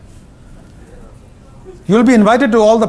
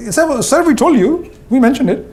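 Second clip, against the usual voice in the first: higher, apologetic "sir, sir" plea; pleading subordinate; 6 semitones higher than usual. Retold or imitated. Employee reminding the CEO he was warned, higher and pleading. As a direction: play the staff member covering himself, pitched up.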